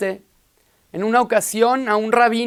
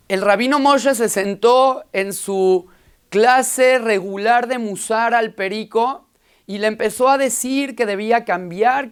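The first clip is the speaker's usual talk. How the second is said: usual speaking voice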